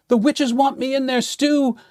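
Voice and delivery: high frightened screech; screeching skeleton. Acted. Skeleton warns ghosts of the witches, screeching. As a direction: shriek the warning to the ghosts. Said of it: "he screeched".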